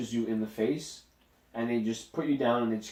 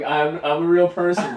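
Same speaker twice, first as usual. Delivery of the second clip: laughing through a put-on tough voice; slow, higher, louder, cracking up; mock tough guy; 5 semitones higher, 8 dB louder than usual. Mocking Aaron's bravado, laughing in a put-on voice. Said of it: "I was gonna do".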